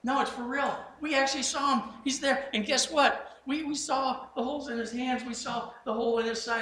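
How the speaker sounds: excited insisting. Retold or imitated. Disciples insist they saw him.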